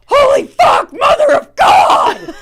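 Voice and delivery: high, loud, drawn-out scream; retold scream. Patient arching up at the injection, re-enacted scream. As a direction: scream it as if jabbed with a needle. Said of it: "arching up and screaming".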